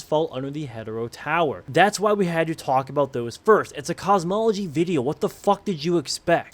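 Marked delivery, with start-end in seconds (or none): none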